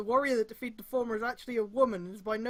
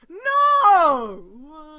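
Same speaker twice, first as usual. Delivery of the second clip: long, much louder and higher no; anguished cry; 5 semitones higher, 16 dB louder than usual. Real, not acted. Reader cries out at the cringe reference. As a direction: howl your protest at the reference.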